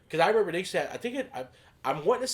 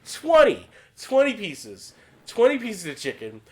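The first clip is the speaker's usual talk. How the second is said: higher and louder, slowed in disbelief; amazed retelling; 8 semitones higher, 5 dB louder than usual. Real, not acted. Comic telling his friend about the huge order, amazed and louder. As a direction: savor the shock of twenty pieces.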